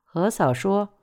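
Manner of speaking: plain reading voice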